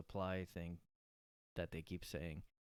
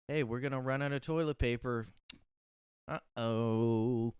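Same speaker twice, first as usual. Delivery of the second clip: mock alarm; 4 semitones higher, 5 dB louder than usual. Mock panicked announcement.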